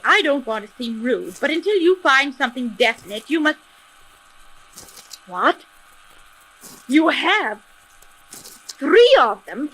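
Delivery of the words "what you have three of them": shocked. Acted. Shocked news on the phone.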